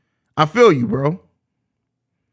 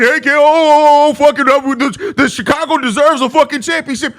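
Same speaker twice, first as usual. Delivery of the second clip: a put-on voice of a fired-up fan, louder and higher; mock voice; 4 semitones higher, 4 dB louder than usual. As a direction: ham up some guy begging for a title. Mocking a fan or player.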